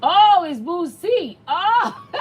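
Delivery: high, loud amused realisation breaking into laughter; delighted shock, then a giggle; real surprised laughing. Real, not acted. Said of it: "I've been saying Boozy name wrong".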